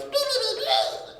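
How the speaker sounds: very high beeps, last one breathy; mock Celebi beeping. Celebi glowing green before time travel, high beeping. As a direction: chirp like a tiny machine. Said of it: "and goes".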